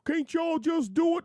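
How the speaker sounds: high, whining mock complaint; whiny complainer. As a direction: whine it like a lazy caller. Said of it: "And I go".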